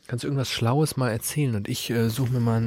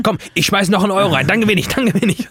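high, loud eager gambler voice; egging himself on to win; excited gambler; 9 semitones higher, 7 dB louder than usual. Retold or imitated. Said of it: "du hast schon gesagt".

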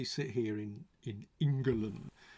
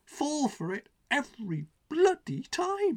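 raised, high-pitched, emphatic exasperation; real despairing tirade, louder and higher; exasperated rant; 9 semitones higher, 7 dB louder than usual. Real, not acted. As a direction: build to a loud, incredulous outburst, dragging out the last words. Climax of exasperated political rant.

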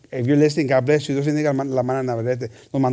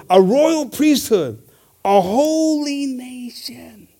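slow, higher mock West Virginian accent; Brother Richard's West Virginian; 7 semitones higher, much slower than usual. Preacher imitates a fellow brother's reading, slow West Virginian accent. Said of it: "Only Brother Richard can talk West Virginian".

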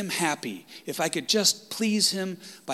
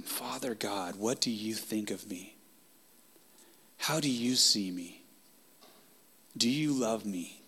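soft prayer; 3 semitones lower, 6 dB quieter than usual. Shown as a demonstration. Modelling a quiet personal prayer.

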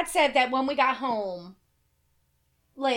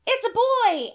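higher, big delivery-room announcement; announcing; 11 semitones higher, 4 dB louder, slower than usual. Retold or imitated. Doctor to a mother in labor, announcing. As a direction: play the doctor declaring it proudly.

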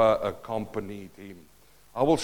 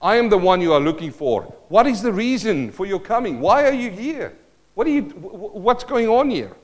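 rising into high-pitched, insistent questions; puzzled questioning; 7 semitones higher, 6 dB louder than usual. Acted. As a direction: act out baffled questioning, climbing higher as it goes. Preacher acts out Peter's puzzled questions.

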